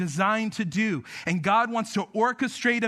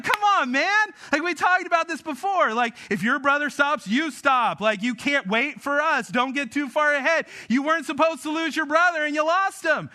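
high, raised-pitch dad telling-off; exasperated scolding; 6 semitones higher than usual. Retold or imitated. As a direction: scold a kid in worried disbelief. Dad scolds son who lost brother.

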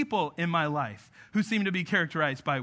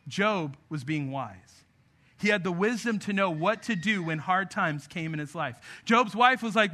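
usual speaking voice

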